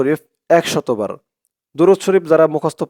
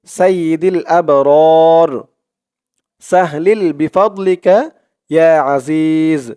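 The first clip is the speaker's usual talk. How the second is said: recite along; much slower than usual. Shown as a demonstration. Teacher leading viewers to recite along, slow devout chant. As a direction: chant it slowly so viewers can follow.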